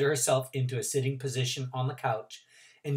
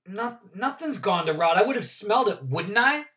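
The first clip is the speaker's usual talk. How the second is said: put-on nervous girl; 7 semitones higher, 5 dB louder than usual. Gilly reassuring, unsure.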